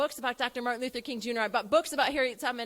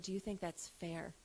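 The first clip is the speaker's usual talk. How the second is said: soft question; 6 semitones lower, 13 dB quieter than usual. Retold or imitated. Mother softly asking.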